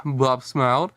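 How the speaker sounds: ordinary delivery, nothing marked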